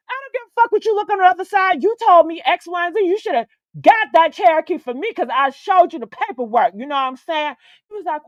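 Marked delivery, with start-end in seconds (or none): 0.1-6.7 high, heated retold rant; indignant telling-off. Customer arguing over the promised Cherokee, indignant. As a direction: fire back in a raised, indignant voice.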